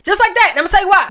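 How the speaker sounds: loud, high sales pitch to men; loud hype. Acted. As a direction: sell it big and loud to the fellas. Hyping her pitch to men.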